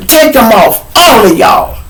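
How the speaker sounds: high, loud order to the group; mad. Acted. Miss Washington stripping all the patrol kids, mad shout. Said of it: "she was mad".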